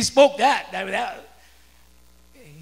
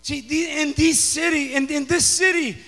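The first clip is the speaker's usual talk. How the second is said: raised, emphatic preacher's voice; rally the church in punchy, loud phrases; forceful preaching; 5 semitones higher, 10 dB louder than usual. Real, not acted.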